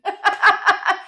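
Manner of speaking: laughing at her own dark joke. Real, not acted. Teller laughing after a grim joke, real laughter.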